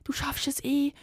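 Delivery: whispered mock reassurance. Mimicking friends who brush off her exam worries, whispered. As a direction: breathe it like a dismissive comforter. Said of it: "dann so sagen".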